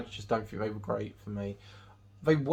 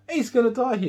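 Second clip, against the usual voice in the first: much higher and louder, a half-mock alarmed cry; alarmed outburst; 12 semitones higher, 8 dB louder than usual. Reviewer reacting as Jose runs in, alarmed high cry. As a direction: blurt it out like you're sure he's doomed. Retold own thought watching film.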